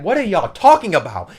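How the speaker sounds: bewildered, voice lifted; exasperated confusion. Retold or imitated. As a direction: demand an explanation, mock-exasperated. Acted confusion among misunderstood characters.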